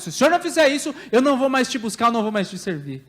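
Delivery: put-on childish tantrum. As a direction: act out a child's defiant demand. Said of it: "atitude de criança quando nós olhamos pra Deus".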